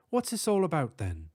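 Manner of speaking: high, fussy British professor; clipped English don asking; British accent. Acted. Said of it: "speaking with a distinct British accent".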